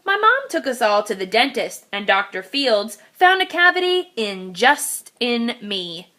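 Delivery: ordinary delivery, nothing marked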